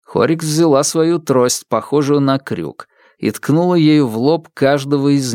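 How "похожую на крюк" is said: usual speaking voice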